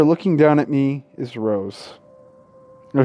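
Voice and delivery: usual speaking voice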